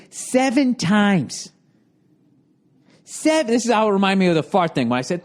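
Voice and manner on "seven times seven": slowly, deliberately imitating the booker; mimicking a smug booker, slow; mock slow emphasis. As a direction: drag it out like a scolding boss. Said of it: "he goes".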